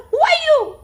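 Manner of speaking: put-on high, loud echo of a boy's call; echoed yell. A narrator's reading. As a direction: yell it back as the mountain's echo. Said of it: "He receives an answer".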